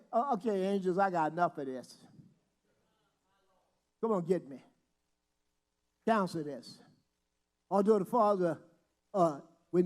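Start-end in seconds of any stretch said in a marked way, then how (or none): 0.1-6.8 lower, softer voice of quitting; a weary sigh of surrender, lower and softer; giving up. Retold or imitated. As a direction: let it sag like you're done. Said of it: "if he would have said".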